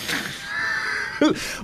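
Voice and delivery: soft, very high giggle; high squealing laugh. Real, not acted. Host breaks up over swinging axes.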